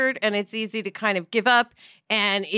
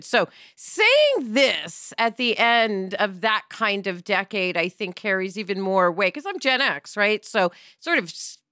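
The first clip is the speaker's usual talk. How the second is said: ordinary talk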